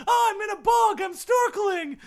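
high, loud hammed-up cry; frantic high mock shouting; mock recap shout. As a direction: yell it like a clip from last season. Mock recap of earlier episodes.

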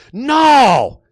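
high, loud explosion of demands; slow, shouted tantrum, every phrase belted; spoiled-child tantrum. A narrator's reading. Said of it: "like a spoiled child not getting what he wants".

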